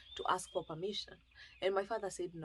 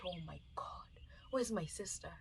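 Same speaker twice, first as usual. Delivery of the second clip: breathless, frightened whisper; 8 dB quieter than usual. Retold or imitated. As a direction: whisper it as the dread hits. Sudden panic at losing sister.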